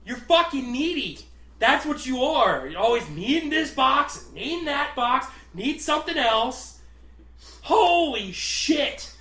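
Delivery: loud, high exasperated tirade; mock snapping. Box guy berating coworker as a joke, mock-furious rant. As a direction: rant at them, hammed-up. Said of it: "I just fucking snapped".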